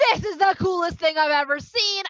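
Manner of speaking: loud, high, hammed-up amazement; awestruck excitement. Moshe seeing the burning bush, thrilled. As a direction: gush loudly at an amazing sight.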